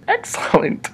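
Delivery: high, soft breathy sarcasm; mock delight at being in the dark; sarcastic excellent. Man alone in dark bathroom, dry mock cheer.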